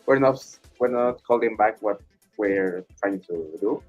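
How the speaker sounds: ordinary delivery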